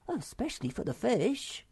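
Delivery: high little squeak; squeaking Sniff. A narrator's reading. Small creature squeaks a worried aside, high. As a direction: pipe it up small. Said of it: "squeaked Sniff".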